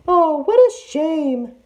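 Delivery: very high, slow, loud sighing falsetto; sighing disguised tiger. A narrator's reading. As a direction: sigh theatrically as the fake auntie. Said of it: "Auntie Tiger sighed".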